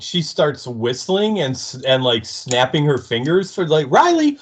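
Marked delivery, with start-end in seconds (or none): 3.9-4.4 very high mock pet call; high sing-song call for Riley. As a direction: coax him out like a dog, high and loud. Buffy calling, whistling for Riley.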